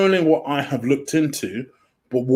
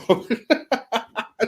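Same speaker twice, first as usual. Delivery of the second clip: high, light burst of laughter; helpless laugh; 10 semitones higher, 3 dB quieter than usual. Real, not acted. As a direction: crack up at the interruption. Dog keeps interrupting the show.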